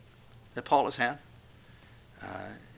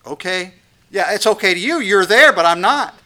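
higher, louder, pleading complaint; anxious parent to God; 6 semitones higher, 10 dB louder than usual. Acted. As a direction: protest to God, voice rising. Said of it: "I can just hear 'em talking to God".

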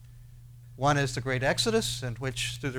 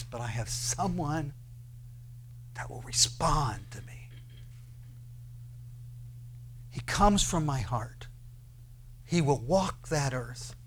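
hushed solemnity; 4 dB quieter than usual. Acted. God speaks tenderly of his servant.

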